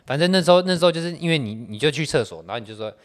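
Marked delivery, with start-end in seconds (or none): none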